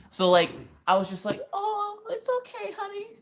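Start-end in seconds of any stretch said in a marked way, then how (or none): 1.5-3.1 very high, soft cooing voice; baby the sad husband, high and gentle; acted tender, high-pitched reassurance; soothing sweet talk.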